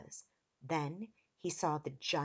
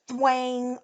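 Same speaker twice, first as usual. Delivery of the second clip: loud, higher twang; twanging arrow noise; 6 semitones higher, 9 dB louder than usual. A narrator's reading. Reader voices arrows shot at children, loud twang. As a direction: sound out a bowstring letting fly. Imitated arrow sound in the story.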